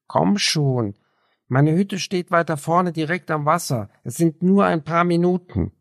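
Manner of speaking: put-on lonely young man pushing his guest to stay; urging young loner. A narrator's reading. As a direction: coax her along eagerly. Raphael urges Clara along.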